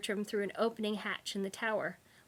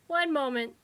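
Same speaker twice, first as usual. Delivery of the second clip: higher, grudging reply; unimpressed but compliant; 5 semitones higher, 6 dB louder than usual. A narrator's reading. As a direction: give in without being impressed. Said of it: "The tone was unimpressed but compliant".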